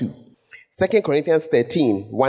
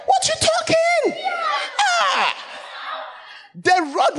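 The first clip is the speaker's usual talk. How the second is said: mock shout; slower than usual. Shepherd scolding the sheep.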